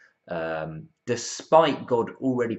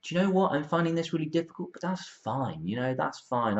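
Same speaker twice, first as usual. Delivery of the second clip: higher-pitched, lightly mocking acceptance; 8 semitones higher than usual. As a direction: pretend to be perfectly fine with it. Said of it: "we don't usually go".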